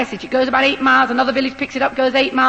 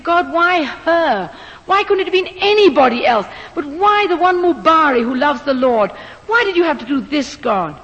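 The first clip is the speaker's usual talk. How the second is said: anguished prayer; 2 semitones higher than usual. Retold or imitated. Despairing prayer before surgery.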